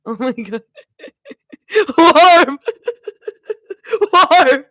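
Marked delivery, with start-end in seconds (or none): 2.0-2.6 high, loud one-word blurt; loud hammed-up outburst; silly yell. Joke word amid laughing fit. 4.1-4.7 high, loud one-word blurt; loud hammed-up outburst; silly yell. Joke word amid laughing fit.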